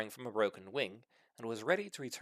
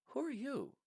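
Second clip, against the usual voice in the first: female dragon; 7 dB quieter than usual. Acted. Female dragon questions Herbert.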